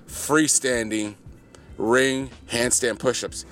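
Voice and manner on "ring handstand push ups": ordinary talk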